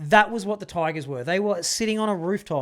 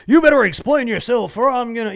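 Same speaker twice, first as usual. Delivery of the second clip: raised, loud movie-guard threat; hammed-up threat, pitched up; mock threatening guard; 5 semitones higher, 9 dB louder than usual. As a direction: act out an angry warning. Guard threatens to throw him off.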